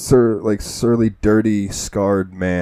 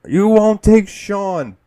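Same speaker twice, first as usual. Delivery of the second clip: high, slow, loud defiant cry; desperate shout; 12 semitones higher, 7 dB louder, much slower than usual. Retold or imitated. A parent refusing to hand over their baby at gunpoint, defiant shout. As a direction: shout it to save the child.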